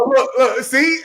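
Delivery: high squealing laugh through the words. Real, not acted. Laughing hard as Troy freezes on camera, squealing. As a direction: crack up while pointing it out. Laughing at Troy's frozen face.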